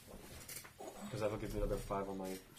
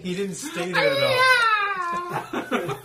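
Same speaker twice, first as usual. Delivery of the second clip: high, loud laughing through words; 17 dB louder, much slower than usual. Real, not acted. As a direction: crack up while saying it. Laughing at the joke.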